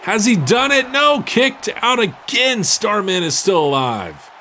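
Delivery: high, loud near-fall call; excited play-by-play. Real, not acted. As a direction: build the hype on the near fall. Near fall called live.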